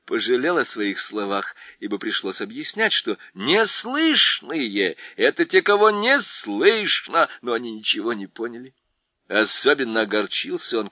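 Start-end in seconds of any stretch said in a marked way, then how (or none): none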